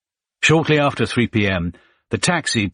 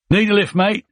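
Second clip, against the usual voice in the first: much higher, louder Cockney soldier; cheeky Cockney offer from the tank hatch; Cockney accent; 13 semitones higher, 4 dB louder than usual. Acted. Tank soldier offers Ross a lift, cheery Cockney accent. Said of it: "in a Cockney accent".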